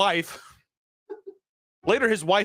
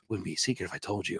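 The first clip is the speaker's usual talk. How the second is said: low, soft, quick sinister reply; creepy quiet kid; 8 semitones lower, 8 dB quieter, faster than usual. Retold or imitated. Child refusing to tell secrets, low soft eerie. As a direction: hush it like a spooky child. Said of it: "kid's eerie reply".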